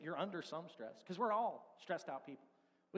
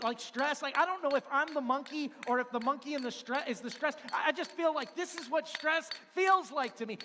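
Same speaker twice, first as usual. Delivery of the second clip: loud, higher, breathless pile-up of worries; mounting stress rant; 5 semitones higher, 7 dB louder than usual. Acted. Preacher to congregation, acting out stress piling up, loud and higher. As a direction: rattle off every burden, getting louder.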